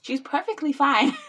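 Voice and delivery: high, loud laugh through the words. Real, not acted. Mom to viewers about crying baby, laughing.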